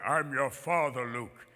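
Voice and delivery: low, slow villain voice; Darth Vader. Acted. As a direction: intone it deep and slow. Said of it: "Darth Vader was the voice of James Earl Jones".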